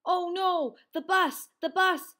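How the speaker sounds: loud, high cry of alarm; panicked cry. A narrator's reading. Froggy sees he'll miss the bus, panicked cry. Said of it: "he cried".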